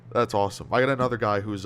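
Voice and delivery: ordinary talk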